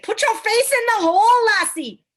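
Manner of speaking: high, loud mock Scottish masseuse; hammed-up Scottish brogue, bossy and playful; Scottish accent. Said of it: "a Scottish massage therapist".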